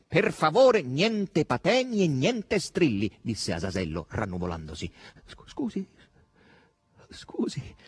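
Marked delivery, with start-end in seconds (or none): 0.1-3.2 loud, high, drawn-out warning; slow, emphatic, high scolding; irritated. A narrator's reading. Irritated warning against hysterics. 5.2-7.8 high, faint stammered apology; meek, hushed, halting apology; murmuring, subdued. A narrator's reading. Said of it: "mormorò Margherita, ormai soggiogata".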